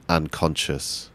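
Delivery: usual speaking voice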